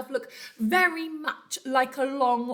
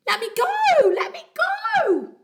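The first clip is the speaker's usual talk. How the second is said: much higher, louder frantic yelling; desperate yell; 10 dB louder, slower than usual. Acted. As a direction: panic while dangling upside down. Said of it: "yelled the enormous crocodile".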